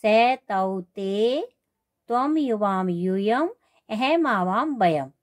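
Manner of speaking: slow, sing-song run through the list; measured memorisation chant; in-order recitation drill. Shown as a demonstration. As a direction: recite the forms in sequence, stretching the vowels. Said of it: "क्रम से ही याद करना पड़ेगा".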